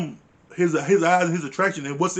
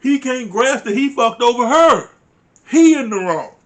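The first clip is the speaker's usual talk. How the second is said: loud, high, emphatic outburst; real indignation; 6 semitones higher, 8 dB louder than usual. Real, not acted. Viewer fed up with Chris's blame-shifting, indignant. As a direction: hammer it out in loud exasperation.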